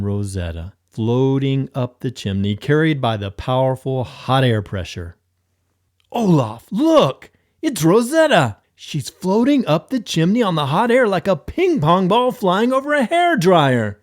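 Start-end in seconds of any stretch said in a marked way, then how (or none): 6.1-14.0 high, loud nerdy excitement; high, loud, eager exclamation; excited geeky exclamation. Acted. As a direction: gush like a science geek. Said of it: "Thor exclaimed like a science geek".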